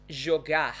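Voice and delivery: JOGAR ending in a throaty H; throat-sound R. Shown as a demonstration. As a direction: say "jogar" as "jo-GAHH". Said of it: "with this throat sound".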